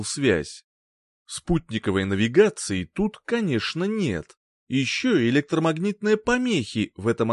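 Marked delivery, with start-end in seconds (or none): none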